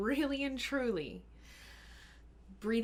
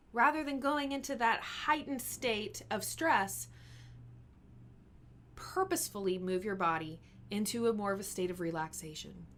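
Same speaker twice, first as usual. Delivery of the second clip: normal talking voice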